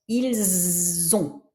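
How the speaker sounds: "Ils ont" linked as il-zont; Z liaison in ils ont. Shown as a demonstration. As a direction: let the S of "Ils" become Z before "ont". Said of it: "on fait la liaison".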